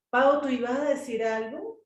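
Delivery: normal talking voice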